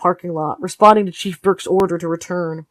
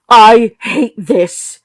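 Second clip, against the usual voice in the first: loud, very slow outburst; mother bursting out to the room, loud and slow; sudden outburst; 4 semitones higher, 8 dB louder, much slower than usual. Acted. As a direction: let it burst out slowly and loud. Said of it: "startled by the sudden outburst".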